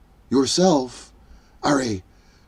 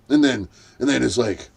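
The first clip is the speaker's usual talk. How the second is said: ordinary delivery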